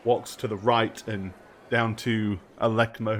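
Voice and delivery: ordinary talk, nothing marked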